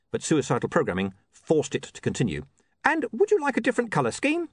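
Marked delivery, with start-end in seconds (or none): 2.8-4.5 high, programmed little helper; relentlessly helpful high-pitched imp; eager imp. Acted. Imp compelled to keep offering options.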